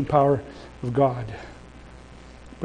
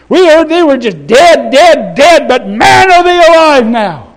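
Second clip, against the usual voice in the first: high, loud excited exclamation; preacher voicing thrilled onlookers; amazed crowd; 7 semitones higher, 8 dB louder than usual. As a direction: shout the astonishing news. Voices amazed crowds hearing of revival.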